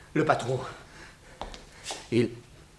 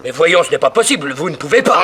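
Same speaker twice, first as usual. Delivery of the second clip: louder, higher pleading; alarmed protest; 7 semitones higher, 5 dB louder than usual. Acted. Assistant pleading with the bandits, alarmed. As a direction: object in alarm.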